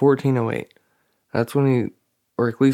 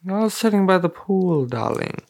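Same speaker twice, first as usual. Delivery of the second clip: posh in-laws; 8 semitones higher than usual. Retold or imitated. Imitating in-laws from American Wedding, mock posh accent. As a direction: put on snooty country-club accent. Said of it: "I'm picturing the, the in-laws from American Wedding".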